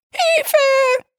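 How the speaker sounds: very high squealing cry for help; squeaky piglet voice. Acted. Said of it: "quiekte eine ganz sonderbare Ferkelstimme".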